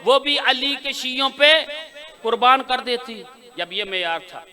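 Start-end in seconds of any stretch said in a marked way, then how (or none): 0.0-3.2 mother's cry, proclaimed. Acted. Preacher voicing the grieving mother to the gathering, loud and drawn out. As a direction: cry out her wish to the crowd. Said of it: "बोलो यार, जो बोल सकते हो".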